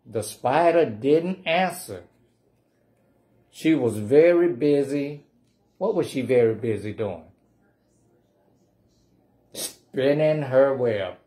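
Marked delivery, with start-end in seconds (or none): none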